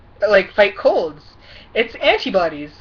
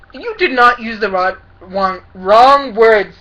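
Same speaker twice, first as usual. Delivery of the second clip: "wrong" drawn out and much louder; indignant stress; 9 dB louder, slower than usual. Real, not acted. Vlogger rebuking a politician's excuse, indignant emphasis. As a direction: hammer "wrong", loud and slow.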